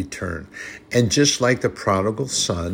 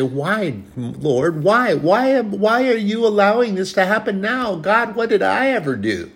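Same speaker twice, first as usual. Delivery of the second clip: high, whiny mock complaint to God; mock whining; 10 semitones higher, 3 dB louder than usual. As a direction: whine it like a self-pitying believer. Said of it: "Instead of whining and crying and say".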